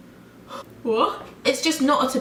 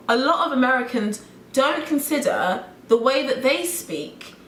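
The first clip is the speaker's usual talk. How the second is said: ordinary talk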